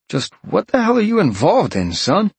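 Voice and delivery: concerned detective on the phone; gruff detective. Acted. Detective voiced.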